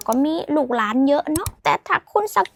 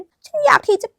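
higher-pitched, exaggerated restatement; mock scandalised reading; 6 semitones higher, 5 dB louder than usual. Teacher voicing what the foreigner hears, mock scandalised reading. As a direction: blurt out the misreading in a raised pitch. Acts out the shocking misreading.

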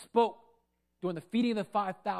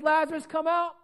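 high, raised summons; commanding, pitched-up call; 7 semitones higher, 4 dB louder than usual. Acted. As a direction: quote the call in a lifted voice. Quoting Jesus calling Lazarus.

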